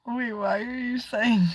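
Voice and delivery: normal talking voice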